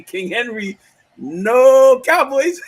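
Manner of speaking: high, slow, laughing yell; yelling across the room. Retold or imitated. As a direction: call out across the office, laughing. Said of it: "I'm yelling across the, across the room to my brother".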